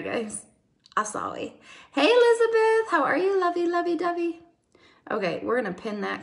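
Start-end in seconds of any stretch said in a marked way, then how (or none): 1.9-4.4 excited greeting. Real, not acted. Greeting a viewer by name.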